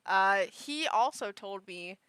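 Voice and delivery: ordinary talk, nothing marked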